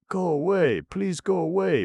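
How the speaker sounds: a frightened sleeper pleading loudly; begging. A narrator's reading. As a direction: beg the elephant to leave. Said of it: "begged Sleeperoo".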